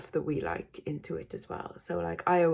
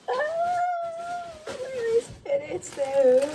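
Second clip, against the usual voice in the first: very high sing-song squeal; drawn-out high-pitched voice; playful searching; 5 dB louder, much slower than usual. Pretend. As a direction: hold a high note while hunting, then land louder. Playfully hunting for the item.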